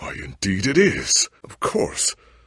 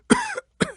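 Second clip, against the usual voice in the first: harsh, high coughing fit; hacking cough. Acted. Sickly hero breaking into a hacking cough. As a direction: cough hard and rough.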